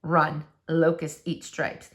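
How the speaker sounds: ordinary delivery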